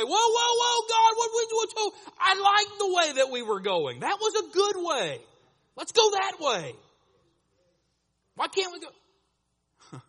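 high, loud, alarmed whoa, then slow pleading; protesting God. Retold or imitated. Preacher protests to God about the turn, loud and pleading. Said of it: "And I wanna say".

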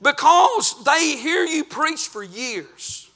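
ordinary talk, nothing marked

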